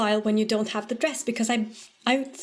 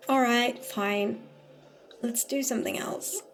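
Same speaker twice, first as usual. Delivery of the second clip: usual speaking voice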